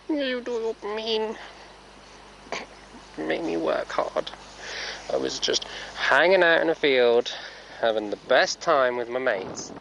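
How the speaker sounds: high cheeky pony voice; voicing the horse. Rider voicing her horse talking back, high cheeky voice. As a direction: speak for the horse, high and sassy.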